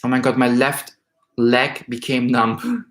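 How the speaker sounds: normal talking voice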